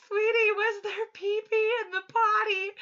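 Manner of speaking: high, loud appalled question; loud mock-horrified mom; horrified laughing shout. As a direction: ask in laughing horror.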